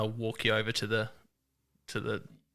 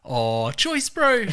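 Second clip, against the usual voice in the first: high, louder, long drawn-out mock Kiwi greeting; frothing Kiwi; 9 semitones higher, 5 dB louder, much slower than usual. Airport guys greet visiting hunters, hammed-up frothing Kiwi. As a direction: imitate a stoked local, stretching the words out. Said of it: "frothing and, like, talking to the airport guys".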